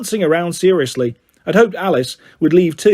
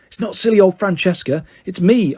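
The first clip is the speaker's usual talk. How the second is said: plain reading voice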